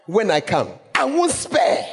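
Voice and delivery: playful Ghanaian warning; Ghanaian way of speaking. Retold or imitated. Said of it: "it's a very Ghanaian way".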